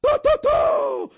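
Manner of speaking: very high, slow squeal; squeaky exclamation. A narrator's reading. Bartek squeals his approval to the crowd, very high. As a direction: squeal with delight, hands rubbing. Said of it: "pisnął".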